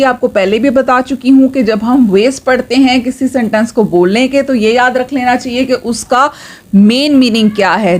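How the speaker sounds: ordinary delivery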